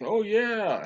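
high, loud imitated cheer; mock crowd-style "yeah" holler; cheering back. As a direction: whoop it like an eager passerby.

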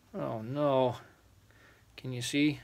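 plain reading voice

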